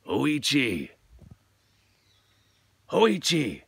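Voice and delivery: ghostly samurai calling his name. Acted. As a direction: call out into the dark. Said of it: "heard the samurai call his name".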